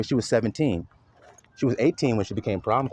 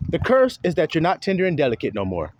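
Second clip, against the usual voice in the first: usual speaking voice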